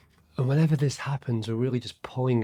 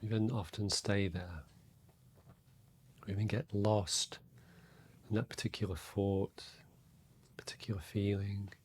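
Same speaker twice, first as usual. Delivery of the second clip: guided meditation; 6 dB quieter than usual. Real, not acted. Meditation teacher to listeners settling into their breath, soft and calm. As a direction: guide them inward quietly, leaving space between phrases.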